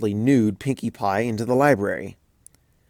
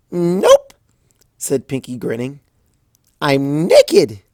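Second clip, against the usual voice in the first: cheerful Pinkie; 3 semitones higher, 6 dB louder, slower than usual. Acted.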